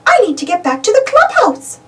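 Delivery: high, reluctant mouse voice; Minnie voice. A narrator's reading. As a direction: protest sweetly in a high voice. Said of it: "says Minnie-rella".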